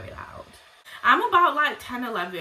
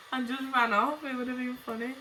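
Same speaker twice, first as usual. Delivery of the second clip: higher voice still caught in laughter; laughing; 4 semitones higher than usual. Real, not acted. Imagining pulling the woman's wig off, laughing. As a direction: talk through the tail of a laugh.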